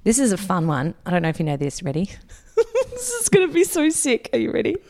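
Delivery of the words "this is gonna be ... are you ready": usual speaking voice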